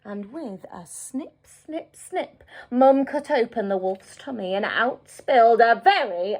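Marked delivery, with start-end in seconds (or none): none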